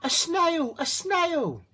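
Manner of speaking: high, loud startled shriek; a teacher spotting a snail; shocked teacher. A narrator's reading. Teacher sees the snail on the board, high and shocked. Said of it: "The teacher turns pale".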